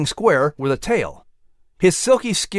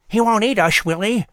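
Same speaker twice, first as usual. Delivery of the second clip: squirrel voice; 6 semitones higher than usual. Acted. Squirrel voice, nervous.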